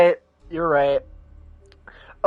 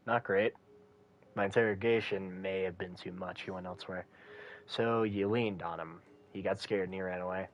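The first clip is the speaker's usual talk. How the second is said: low, subdued detective talk; a hint of a weary phone report; lightly voiced detective; 5 semitones lower, 8 dB quieter than usual. Voices characters on a call.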